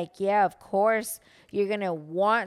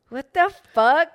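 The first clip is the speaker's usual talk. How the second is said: loud, slow, half-laughing mock shock; shocked reaction; 6 semitones higher, 8 dB louder, slower than usual. Girlfriend retells hearing his phone voice, loud laughing shock. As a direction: act stunned at a fake voice. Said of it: "I remember the first time I heard it".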